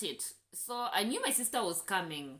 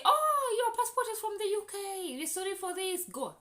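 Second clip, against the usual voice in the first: high, bright mock official voice, much higher and louder; mimicking airport officer; 13 semitones higher, 5 dB louder than usual. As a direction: ham up a sugary airport officer suddenly letting her go. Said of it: "So they say".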